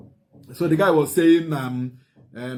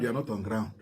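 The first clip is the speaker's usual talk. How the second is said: drops soft to drive the point home; dropped quiet repeat; 5 semitones lower, 9 dB quieter than usual. Real, not acted. Commentator telling Ikenga Uruagu he lacks facts, softer repeat. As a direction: repeats it quietly as a knowing aside. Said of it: "if you are not on ground".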